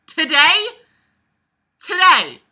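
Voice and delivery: loud, high incredulous yell; incredulous outburst. Real, not acted. Reader catching a wrong word in the book, incredulous yelling. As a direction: shout the word in disbelief. Outraged at the book's tense.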